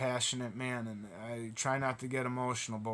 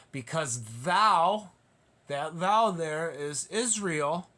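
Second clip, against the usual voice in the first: slow, weighty preacher's reading, leaning on thou; solemn scripture reading; 4 semitones higher, 6 dB louder, slower than usual. Acted. Preacher to congregation, slow solemn scripture reading. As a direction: read each word as holy writ. Scripture read slowly, emphatically.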